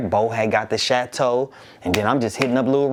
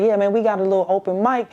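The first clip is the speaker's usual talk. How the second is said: Dwight's voice; 8 semitones higher, faster than usual. Retold or imitated. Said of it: "He's like".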